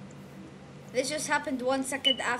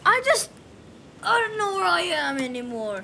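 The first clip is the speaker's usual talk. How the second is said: exasperated outburst; 6 semitones higher, 6 dB louder than usual. Real, not acted. Lost and teleported in the game.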